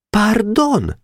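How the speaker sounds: a modest, very high little "Pardon?"; meek remark. Acted. Weiss meekly interrupting the teacher, modest. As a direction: object humbly. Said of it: "jegyezte meg szerényen Weiss".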